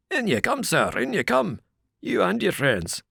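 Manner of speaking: suddenly friendly doorman; welcoming porter. A narrator's reading. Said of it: "he answered".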